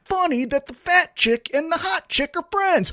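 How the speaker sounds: high-pitched sarcastic imitation; mocking scorn. Fan parodying the boss's storyline, mocking scorn.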